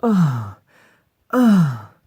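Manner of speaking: very loud, high acted moans; hard moan. Shown as a demonstration. Voice actor demonstrating line five, hard loud moans. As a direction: moan hard at full volume. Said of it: "Hard fucking one".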